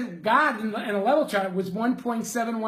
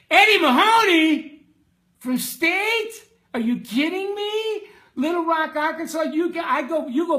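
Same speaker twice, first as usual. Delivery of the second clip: higher, louder happy shout; delighted surprise; 6 semitones higher, 4 dB louder than usual. Real, not acted. Delighted to see an old friend.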